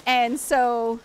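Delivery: usual speaking voice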